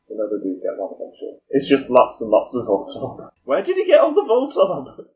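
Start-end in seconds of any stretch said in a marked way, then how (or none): 3.4-5.1 incredulous. Real, not acted.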